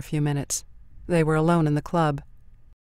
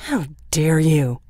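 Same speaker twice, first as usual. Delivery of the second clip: slow, louder, spitting each word; spat; 6 dB louder, slower than usual. A narrator's reading. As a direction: spit it at her in contempt. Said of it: "he spat at her".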